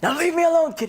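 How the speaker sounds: annoyed thief. Retold or imitated. Thief brushes him off.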